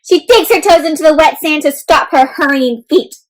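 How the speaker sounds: usual speaking voice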